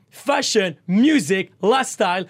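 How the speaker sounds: ordinary talk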